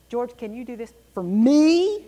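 "me" loud, long and rising at the end; stress on me. Shown as a demonstration. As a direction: build to a big, drawn-out "me". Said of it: "You see how I put it?".

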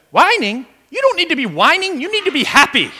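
put-on high, bright scolding voice with an accent; mock the friend correcting the caller; Jerry's retort. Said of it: "Jerry responds and goes".

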